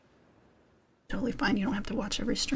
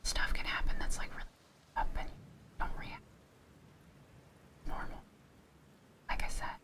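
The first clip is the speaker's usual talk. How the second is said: soft breathy ASMR whisper; 5 dB quieter than usual. Real, not acted. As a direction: whisper close to the mic. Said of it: "a whisper session in an A S M R".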